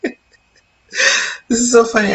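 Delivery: slow, laughing through the words. Real, not acted. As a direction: laugh at your own forgetfulness. Laughing at forgetting word.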